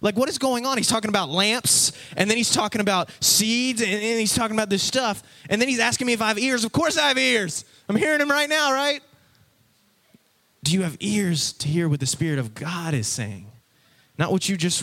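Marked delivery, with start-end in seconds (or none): 0.0-9.0 high-pitched, exasperated disbelief; baffled indignation. Acted. Baffled listener asking what Jesus is talking about, incredulous. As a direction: protest in a rising, bewildered voice.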